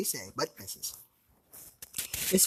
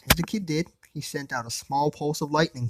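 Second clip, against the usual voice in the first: ordinary delivery, nothing marked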